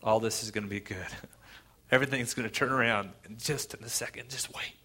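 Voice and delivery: soft, tender reassurance; compassionate. Retold or imitated. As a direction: comfort them gently, feeling their pain. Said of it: "He says it with compassion".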